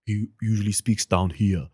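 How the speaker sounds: lightly voiced action-star impression, firm and loud; Arnold. Shown as a demonstration. Guest shows another side of an impression, Arnold voice. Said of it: "Arnold is always, like, shouting".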